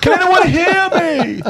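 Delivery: high, drawn-out call for help; hammed-up castaway calling across the water; stranded shipwreck survivor. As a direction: stretch it out slowly in a very high voice. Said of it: "I'm one of the guys on the boat".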